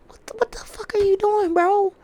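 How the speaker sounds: squeezed, very high breathy disbelief; strained exasperation. Real, not acted. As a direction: voice thins right out in exasperation. Exasperated at LeBron.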